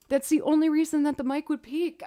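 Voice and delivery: usual speaking voice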